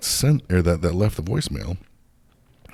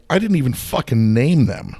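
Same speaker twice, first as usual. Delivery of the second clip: louder, raised voice in disbelief; indignant retort; 7 semitones higher, 5 dB louder than usual. Retold or imitated. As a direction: fire it back, outraged. Retelling his own outraged reply.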